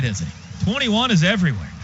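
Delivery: usual speaking voice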